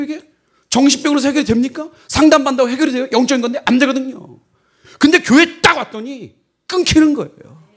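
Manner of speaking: loud, high, urgent preaching; fiery preaching. Acted. Pastor preaches fervently to the congregation about spiritual power. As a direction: proclaim it with rising fire to the congregation.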